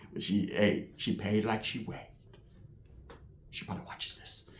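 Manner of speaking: very soft, dropping to a whisper; hushed aside. Real, not acted. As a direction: confide it under your breath. Aside about woman possibly watching.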